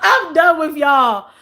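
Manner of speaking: laughing, slow and breaking; cracking up while talking. Real, not acted. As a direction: done with them through laughter. Laughing at chat comments.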